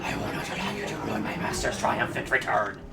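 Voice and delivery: low, menacing villain voice. Pretend. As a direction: play a sinister boss villain. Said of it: "I'm trying to come up with a good villain voice".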